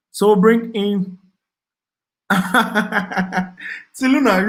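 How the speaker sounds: laughing through the name, slow; cracking up while calling out a viewer. Real, not acted. Host laughing at a viewer's joke, laughing.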